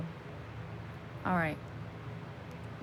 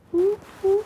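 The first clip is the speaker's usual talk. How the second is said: siren whoop; 9 semitones higher, 9 dB louder than usual. Retold or imitated. Imitating a police siren.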